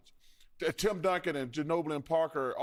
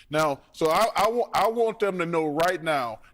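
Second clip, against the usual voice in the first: louder, pounding every point home; emphatic loud statement; 5 dB louder than usual. Real, not acted. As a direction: drive the message home, loud and firm. [name] sending a message to Warriors.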